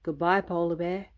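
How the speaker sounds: plain reading voice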